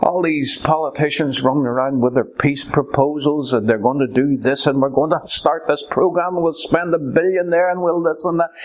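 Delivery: ordinary talk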